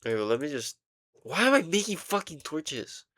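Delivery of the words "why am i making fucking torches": exasperated outburst. Real, not acted. Frustrated at own game misclick.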